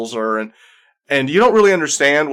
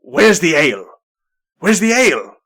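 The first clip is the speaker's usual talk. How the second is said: rising, louder call for ale; demanding ale; 4 semitones higher, 4 dB louder than usual. Acted. Dwarf Mari calling out for ale on arrival, loud and eager. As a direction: bellow the demand twice. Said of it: "Mari goes".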